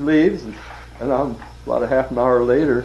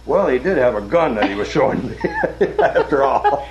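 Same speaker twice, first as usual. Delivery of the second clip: higher, louder, breaking into laughter; laughing excuse; 6 semitones higher, 6 dB louder than usual. Retold or imitated. Rep explains the gun to his boss, retold laughing excuse. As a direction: protest sheepishly, cracking up by the end.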